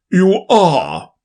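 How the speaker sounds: booming king; pompous king, loud and grand. Acted. King answers.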